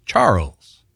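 Southern two-syllable Charles; Southern pronunciation. Shown as a demonstration. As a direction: say Charles Southern-style, as CHAR-uls. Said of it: "in the Southern dialect in America".

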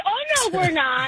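high-pitched, amused denial; emphatic retold denial. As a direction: act out a hasty correction, pitch leaping up. Acted denial to addicts in waiting room.

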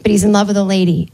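normal talking voice

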